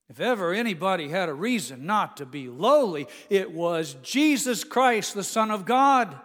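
rising preacher's emphasis; emphatic preaching. Acted. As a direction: drive home his rank.

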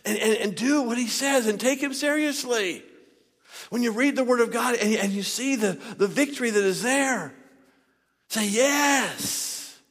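forceful preaching. Real, not acted. Preacher urging the church to take God seriously, forceful preaching. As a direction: build to a shouted final word.

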